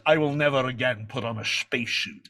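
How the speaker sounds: emphatic Scottish star impression; Sean Connery. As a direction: imitate the film legend swearing it off.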